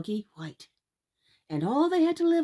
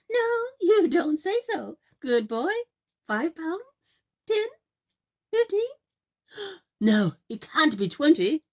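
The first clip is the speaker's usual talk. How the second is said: high, louder, gasping excitement; excited guessing; 10 semitones higher, 6 dB louder than usual. Acted. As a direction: guess higher and higher. Mother guessing the price excitedly.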